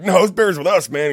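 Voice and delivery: loud, high protest of innocence; mock insistence. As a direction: insist loudly it's not stolen. Insisting to suspicious cab driver.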